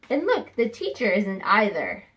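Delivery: normal talking voice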